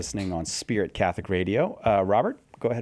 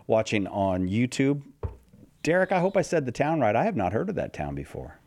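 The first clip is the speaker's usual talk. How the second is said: normal talking voice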